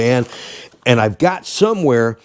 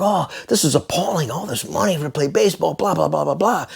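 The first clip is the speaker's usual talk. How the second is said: mock grumbling; 5 semitones higher, 4 dB quieter than usual. Imitating people's grumbling about salaries.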